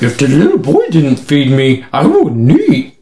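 dog voice, slowing at the end; talking dog. Acted. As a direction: complain as a put-on dog character. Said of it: "He's like".

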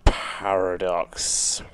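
slow, drawn-out musing; slow pondering. Real, not acted. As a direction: stretch it out while thinking. Pondering the question aloud.

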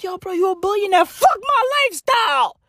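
fast, then a high shouted squeal; mock whiny billionaire. Comic mocking Elon's self-pity, fast then squealing. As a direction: rattle it off, then squeal the complaint.

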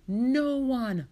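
"No one" pushed high and loud; emphatic. A narrator's reading. Storyteller stressing "No one" else heard, high and emphatic.

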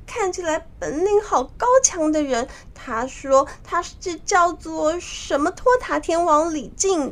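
high-pitched, panicky report; alarmed little monkey. Acted. Little monkey reports intruders to the king, alarmed and high. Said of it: "不禁大惊失色".

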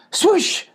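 high swooshing wind noise; high whoosh sound. Acted. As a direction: whoosh like a tornado.

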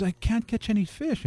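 put-on high childlike whine; little girl voice, high and plaintive. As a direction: play the sad little girl by the falls. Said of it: "She says".

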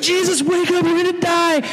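high-pitched mock panic; panicked disciples. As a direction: cry out in hammed-up alarm.

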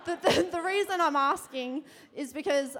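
sheepish laughing stammer. Real, not acted. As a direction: giggle nervously as you explain.

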